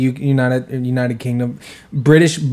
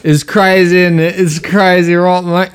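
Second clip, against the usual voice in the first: higher, louder mock British lad; British accent; 6 semitones higher, 6 dB louder than usual. Prankster showing off to a group of guys, loud fake British accent. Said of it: "you like my accent".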